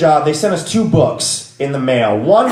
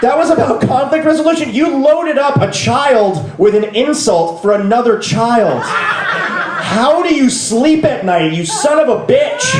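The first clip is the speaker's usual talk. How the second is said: outraged yell; 14 semitones higher than usual. Acted. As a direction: scream disbelief at the absent VP. Comic rant about the VP's story.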